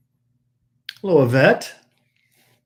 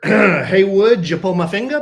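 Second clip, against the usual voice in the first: booming tipsy uncle; 7 semitones higher, 10 dB louder than usual. Acted. Mic check in silly voice.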